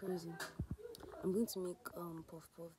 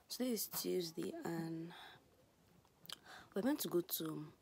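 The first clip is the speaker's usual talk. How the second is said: usual speaking voice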